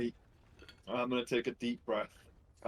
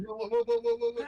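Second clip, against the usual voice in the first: very high, loud string of whoas; alarmed whoas; 6 dB louder than usual. Acted. Player reacting as a character jumps, alarmed whoas. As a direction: cry out high to halt someone.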